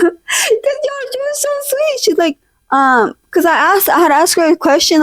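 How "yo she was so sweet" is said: squeaking the words out through real laughter; telling friends the recruiter was sweet, breaking into high, soft laughter; laughing high and soft. Real, not acted. Laugh-cry squeak, much higher and softer.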